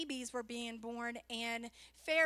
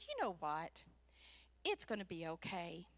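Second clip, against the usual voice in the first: low, soft imitation of a kindly policeman; deep, quiet reassurance; reassuring officer; 9 semitones lower, 7 dB quieter than usual. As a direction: let the driver off gently, pitched down. Voices officer letting her off.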